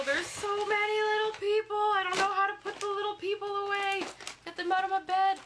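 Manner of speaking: high, loud squeaky little-person voice; tiny squeaky voice. Pretend. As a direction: squeak it out like a tiny creature complaining. Repeats chat in squeaky voice.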